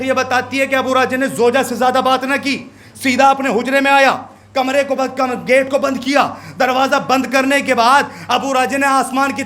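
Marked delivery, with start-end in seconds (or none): none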